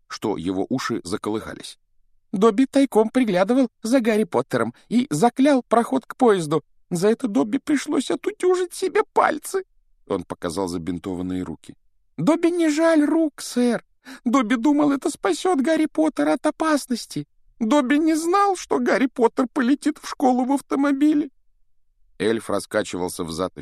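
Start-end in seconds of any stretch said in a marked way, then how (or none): 2.3-9.6 high, squeaky pleading elf; house-elf voice. Acted. Dobby answers proudly. 12.2-21.3 high, squeaky pleading elf; house-elf voice. Acted. Dobby explains himself.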